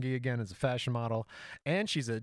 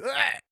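sharp high grunt as the back twinges; a sudden loud groan of pain; 14 semitones higher, 6 dB louder than usual. Retold or imitated. Bending for the toilet lid, his back gives a loud grunt. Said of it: "my back was like".

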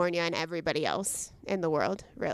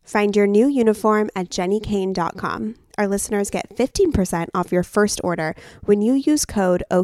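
ad read; 8 dB louder than usual. Acted. Host reads promo code.

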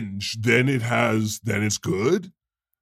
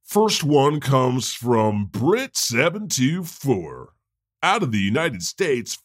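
normal talking voice

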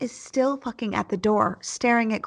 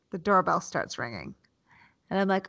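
ordinary talk, nothing marked

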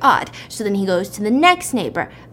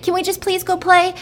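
high mock-child plea; begging kid; 8 semitones higher than usual. Kids asking mom to go play, pleading. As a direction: wheedle like a child who wants permission. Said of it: "her kids are like".

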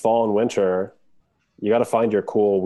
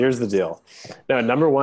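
mock debater, raised pitch on the points; debate voice; 5 semitones higher than usual. Podcast host listing reasons for weird hats, mock debate voice. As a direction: lightly play the candidate making points. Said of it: "This is my debate voice".

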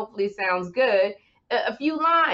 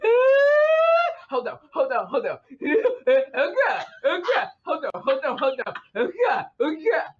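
mock laughing fit; 3 semitones higher than usual. Shown as a demonstration. [name] demonstrating Kim's endless giggling. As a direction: act out a fit of giggles you can't stop. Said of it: "That's what she was doing".

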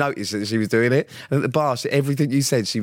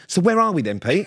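higher-pitched mock pestering question; retold bickering; 7 semitones higher, 3 dB louder than usual. Asking Pete where the seats are, mock pestering. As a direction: imitate someone pestering a mate. Acted bickering over lost tickets.

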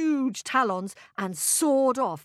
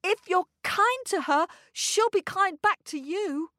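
high, earnest pleading; begging boy; 8 semitones higher than usual. A narrator's reading. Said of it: "begged the boy".

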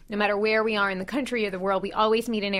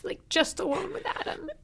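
crying; 10 semitones higher, 4 dB quieter than usual. Real, not acted. Grief memory, crying heard.

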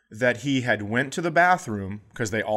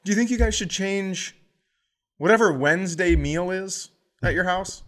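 sarcastic inner thought; 5 semitones higher than usual. Retold or imitated. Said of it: "I just think to myself".

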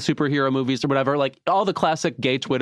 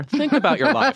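mock stern; 11 semitones higher, 3 dB louder than usual. Scolding the gushing fans.

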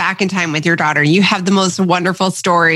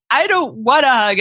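higher-pitched mock pout; imitated mad daughter; 5 semitones higher than usual. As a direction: mimic a child's mildly cross refusal. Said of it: "my daughter's mad at me".

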